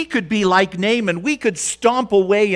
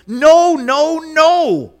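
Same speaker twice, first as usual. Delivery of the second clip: "No, no, no" shouted much higher and louder; defiant shout; 10 semitones higher, 8 dB louder, much slower than usual. Pretend. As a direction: burst out in protest. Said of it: "wants to rise up and say".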